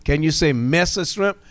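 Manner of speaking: ordinary delivery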